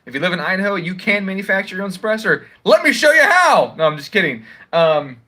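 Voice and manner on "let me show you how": high, slow, loud mock enthusiasm; mock eager offer. Joking to gun viewers, mock eager promise. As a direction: sell it like a cheesy infomercial host.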